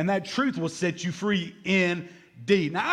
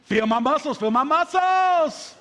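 higher, louder, slow boasting; mock proud man; 7 semitones higher, 5 dB louder, slower than usual. Grown husband begging his wife to admire him, slow and loud. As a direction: drag it out like a showing-off husband. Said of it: "grown men in their fifties say".

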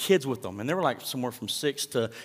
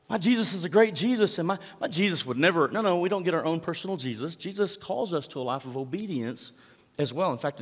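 usual speaking voice

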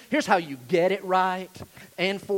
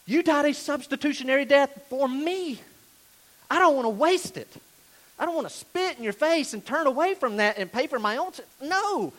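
raised, urgent pulpit appeal; impassioned preaching; 6 semitones higher, 4 dB louder than usual. Real, not acted. Preacher urging the congregation to be saved now, impassioned. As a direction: preach it with pleading conviction.

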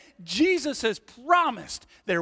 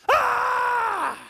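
high, drawn-out scream; hammed-up scream of terror on a tiny drop; mock panicked shriek; terrified ride scream; 4 dB louder than usual. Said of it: "But to me, it's".

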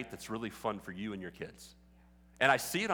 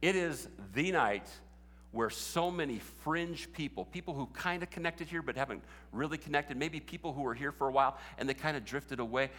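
usual speaking voice

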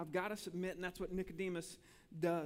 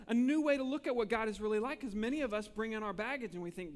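ordinary talk, nothing marked

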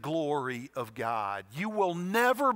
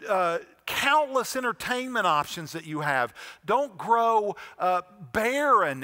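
high, loud, urgent exhortation; rally the church awake; preaching at full force; 5 semitones higher, 5 dB louder than usual. Acted.

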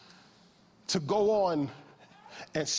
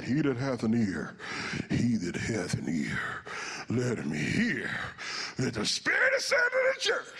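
threatening; 5 semitones lower than usual. Shown as a demonstration. Preacher showing how he used to read scripture, threatening. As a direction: intone it as a dire threat, then erupt. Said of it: "Anybody else read it like that?".

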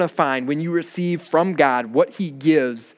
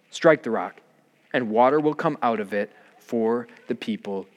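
measured, solemn Bible reading; scripture reading; 2 semitones lower than usual. Acted. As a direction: read the passage with reverent weight. Scripture reading before the sermon.

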